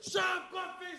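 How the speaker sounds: high-pitched angry mock shout; hecka loud yell. Brother losing his temper at the girls, hecka loud yell. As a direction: re-enact the outburst at full stretch. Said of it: "Like, hecka loud".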